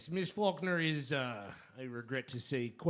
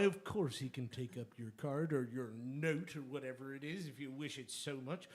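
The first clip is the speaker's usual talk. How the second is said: usual speaking voice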